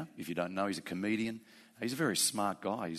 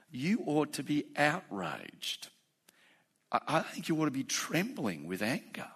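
raised, impassioned sermon voice building in force; fervent preaching; 6 semitones higher than usual. Acted. Preacher rebuking an atheist's words to his congregation, impassioned. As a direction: preach it hard, rebuking the scoffer.

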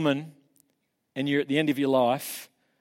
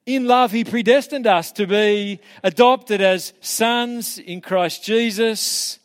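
a proud, slowed scripture recital after recalling it; triumphant recitation; 5 semitones higher, 5 dB louder than usual. Shown as a demonstration. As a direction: declaim the verses with rising joy. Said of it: "give you a few verses".

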